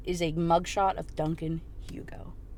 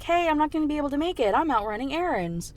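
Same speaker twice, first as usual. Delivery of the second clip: lightly acted quick, higher phone voice; mock-casual, a touch hurried; breezy excuse; 7 semitones higher than usual. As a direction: toss off a casual cover story.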